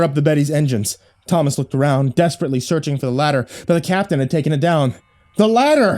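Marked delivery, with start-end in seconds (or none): 5.4-6.0 screaming. A narrator's reading. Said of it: "he screamed".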